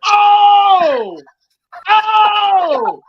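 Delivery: loud, high burst of delight; excited shout. Real, not acted. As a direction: shout out with joy. Reacting to the reveal.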